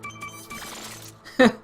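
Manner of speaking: squeaky burst of laughter; high laugh. Real, not acted. Laughing at game.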